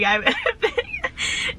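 slowed down, giggling through "made it big"; amused by her own joke; laughing at herself. Real, not acted.

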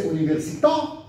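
università with the final TÀ punched; stress on last syllable. Shown as a demonstration. Teacher demonstrates French and Italian università, stress on last syllable. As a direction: save the punch for the end of università. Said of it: "Last syllable".

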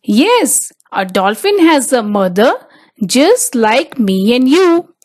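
ordinary delivery

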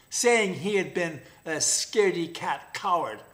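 usual speaking voice

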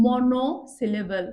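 "Monosyllable" slow, as মনো, syllable; term in pieces. Shown as a demonstration. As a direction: draw out "monosyllable". Teacher spells out term. ("Monosyllable" ধীরে, ভেঙে)

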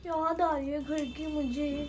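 slow, soft teasing imitation; mock homesick whine. Teasing her sister by mimicking her homesick whine, soft and slow. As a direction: draw out the words like she is about to cry. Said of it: "तू कितनी बार तो बोलती है".

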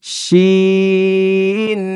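শিন stretched very long, steady pitch; slow, held শিন for a class; drawn-out shin. Shown as a demonstration. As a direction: hold শিন long like a chant. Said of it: "বলেন".

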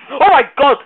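loud high burst of panic; panicked scream. Real, not acted. Player screams as a skeleton appears, panicked. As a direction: scream as if caught off guard. Sudden danger in game.

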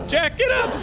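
very high loud yell of alarm; shouting at Jack to get up; panicked shout. Real, not acted. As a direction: scream his name at the screen. Panicked yell at game character.

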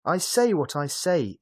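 high-pitched defiant schoolboy; indignant boy standing his ground; defiant cry. A narrator's reading. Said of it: "Emil cried".

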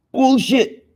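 bullshit shot up high in outrage; outraged. Real, not acted. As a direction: blurt it in disbelief. Rant about overpriced parts.

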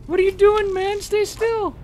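teasing falsetto at a dodging bandit; mock high squeaky voice. Player mocking the enemy in falsetto. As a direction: pitch way up, mocking.

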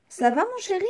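high, singsong wifely greeting; wolf's wife. Acted. Wife asking her wolf husband how he is, high voice.

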